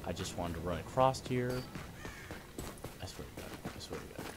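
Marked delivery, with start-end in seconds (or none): 3.0-4.3 soft, near-whispered swearing; quiet exasperated muttering; muttered under breath. Real, not acted. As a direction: mutter it through frustration.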